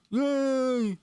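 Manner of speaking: very high, slow cartoon voice; drawn-out squeaky high voice; squeaky character voice. Same squeaky voice cheering.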